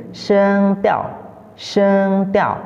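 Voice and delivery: "sheng tiao" with high held first syllable, falling second; Mandarin term demo. Shown as a demonstration. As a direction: sustain "sheng" high, drop sharply on "tiao". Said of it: "Nada dalam bahasa Mandarin".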